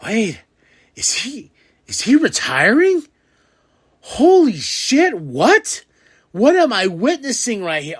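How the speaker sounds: stunned fan watching a star retire, shocked and high; shocked disbelief. Retold or imitated. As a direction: voice jumping high and loud in shock. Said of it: "I was like".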